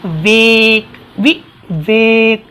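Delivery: वि and वेक split and said very slowly; teacher spelling out वि-वेक slowly; slow syllables. Shown as a demonstration. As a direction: break Vivek into syllables. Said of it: "जैसे विवेक बोले".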